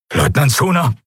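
high, breathless command; breathless order. Acted. Admiral ordering full thrust at last hope, breathless and high. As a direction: start the order short of breath, pitch climbing. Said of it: "begann der Admiral atemlos".

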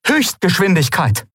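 high, emphatic order; urgent command. Acted. Aylan orders top speed from the ship, commanding. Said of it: "befahl Aylan".

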